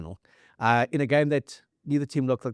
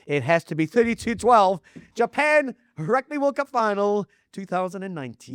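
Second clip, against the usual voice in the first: high and loud, building to the big reveal; excited announcement; 8 semitones higher, 4 dB louder than usual. Real, not acted. Host crowning the 2019 final as number one, excited. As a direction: proclaim the winner.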